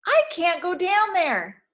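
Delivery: high, slow, frightened cry; pleading in real fear, stretched out; cried with fear. A narrator's reading. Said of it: "she cried with fear".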